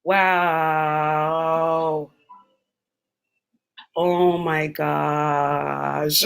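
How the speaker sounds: low, very drawn-out words; stunned. Real, not acted. Taking in her favorite player's death, stunned. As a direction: let each word sink slowly in shock.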